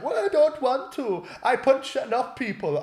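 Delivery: very high put-on protest; squeaky imitation of a reluctant fighter; mock opponent. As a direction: mimic the other lad backing down, pitched right up.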